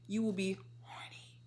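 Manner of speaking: conspiratorial whispered aside. Acted. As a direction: whisper it like a secret. Punchline delivered as aside.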